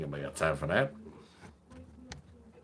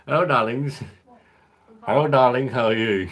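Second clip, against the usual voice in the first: campy drag queen; 6 semitones higher, 9 dB louder than usual. Pretend. Camp greeting to stream viewers.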